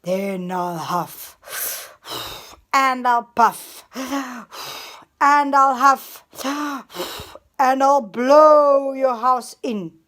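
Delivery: slow, building to loud menacing growl; threatening wolf. Acted. Wolf threatening to blow the stick house in, growing loud.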